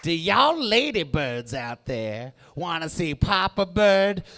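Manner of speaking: a strutting bird wooing the ladies; seductive male bird. Acted. Comic voicing a male bird courting ladybirds, slow and crooning. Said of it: "He's like".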